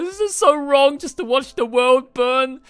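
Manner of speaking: put-on innocent child voice. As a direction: ask it like an innocent child wanting a toy. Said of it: "he said it so innocently".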